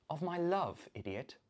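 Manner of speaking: normal talking voice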